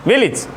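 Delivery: विलेज said as "vilij", short i in the last syllable; village said right. Shown as a demonstration. Teacher models the right way to say विलेज, as "vilij". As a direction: say विलेज ending in "ij", not "age". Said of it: "not village".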